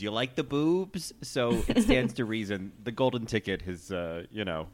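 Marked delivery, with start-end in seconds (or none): 0.0-1.1 Dina. Retold or imitated. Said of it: "your tagline on the show is".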